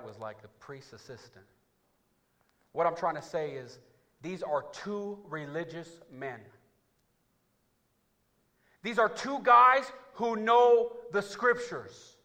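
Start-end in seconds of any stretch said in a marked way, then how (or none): 2.7-12.2 high, loud, slow preacher's cry; full-force sermon climax; preaching shout. Acted. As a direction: drive the point into the pews.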